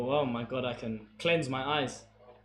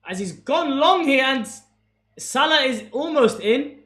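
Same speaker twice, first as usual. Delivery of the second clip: excited play-by-play, higher, louder and slow; live commentary; 8 semitones higher, 8 dB louder, slower than usual. Acted. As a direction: call the attack as it builds.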